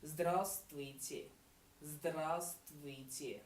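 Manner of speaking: здравствуйте drawn out very slowly, each sound clear; slow demo. Shown as a demonstration. Teacher showing students how to say здравствуйте slowly. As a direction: stretch out здравствуйте for learners.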